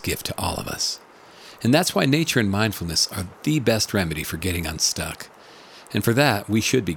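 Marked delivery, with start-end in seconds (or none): none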